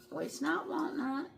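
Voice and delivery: ordinary talk, nothing marked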